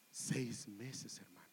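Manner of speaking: hushed whispered reveal. Acted. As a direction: say it like a secret to the room. Preacher reveals ministry length. (revelación susurrada)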